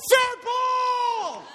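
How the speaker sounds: high, loud umpire shout; the umpire shouting fair ball; yelled call. Retold or imitated. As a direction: call it out with full force. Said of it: "I yelled".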